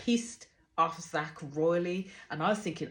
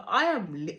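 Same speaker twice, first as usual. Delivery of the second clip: ordinary talk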